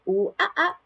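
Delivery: high playful monkey hoots; lively imitation of monkey chatter; monkey call. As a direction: hoot like a monkey for a toddler. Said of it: "The monkey says".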